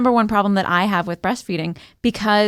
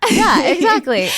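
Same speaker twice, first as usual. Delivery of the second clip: laughing through the words; amused, breaking into laughter; 8 semitones higher, 5 dB louder than usual. Real, not acted. Laughing agreement.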